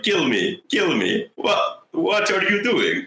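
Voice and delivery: high-pitched mock French, closer to Dracula; botched French accent. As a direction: imitate a French woman with a wobbly Dracula-like accent. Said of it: "sounded more like Dracula than French".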